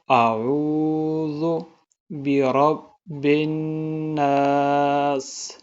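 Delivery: slow chanted قل اعوذ with clear vowels; correct recitation. Shown as a demonstration. Teacher showing students the right recitation, slow chant. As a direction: recite slowly and melodically. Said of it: "آپ نے پڑھنا ہے".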